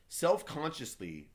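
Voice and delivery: usual speaking voice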